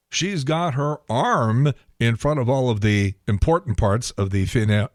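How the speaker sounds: normal talking voice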